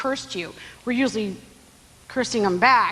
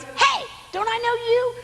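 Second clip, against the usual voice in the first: menacing recognition; 12 semitones higher than usual. Retold or imitated. Forgiven servant meets debtor.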